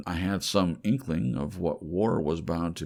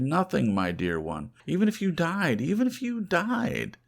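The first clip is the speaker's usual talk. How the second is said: much higher, louder, building to a cry; impassioned declaration; 11 semitones higher, 7 dB louder than usual. Acted. Lover swearing to stay with her, loud rising passion. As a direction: pour out a lover's defiant vow, rising in pitch. Passionate vow to his beloved.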